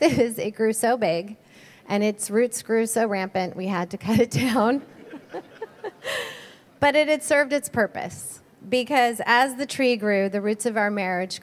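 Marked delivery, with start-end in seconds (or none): 5.1-6.3 soft, high-pitched chuckle; amused laugh. Real, not acted. Speaker admitting the beloved tree was cut down, amused laugh. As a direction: laugh lightly at the irony.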